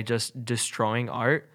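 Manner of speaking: ordinary delivery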